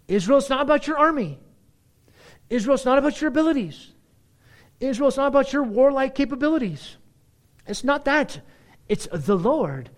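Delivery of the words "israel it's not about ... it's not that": fast, high, loud preaching; preaching at full force. Acted. Preacher pressing the point on Israel, fast and loud. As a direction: drive it home to Israel.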